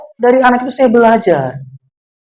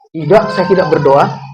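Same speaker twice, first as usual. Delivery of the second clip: wife answering; 7 semitones lower, 3 dB quieter than usual. Acted. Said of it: "Istrinya jawab".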